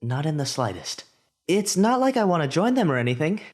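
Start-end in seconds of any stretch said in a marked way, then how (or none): 1.5-3.4 higher, a touch defensive; defensive boy. A narrator's reading. Izuku answering the class president, lightly defensive. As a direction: brush it off awkwardly.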